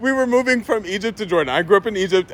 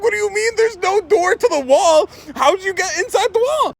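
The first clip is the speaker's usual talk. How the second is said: high panicked mom; 13 semitones higher than usual. Retold or imitated.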